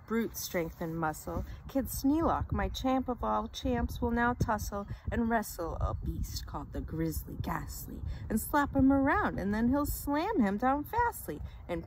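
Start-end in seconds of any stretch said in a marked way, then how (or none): none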